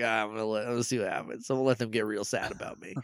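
smug mock aside. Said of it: "just to be like".

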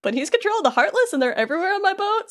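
high-pitched retold whining; drama queen. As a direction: play a mildly hammed-up drama queen complaining. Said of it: "after Hook's done being a s-, uh, drama queen".

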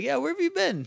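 high, curious friend's question; a lightly voiced, prying buddy; mock concern. Rocky voiced asking.

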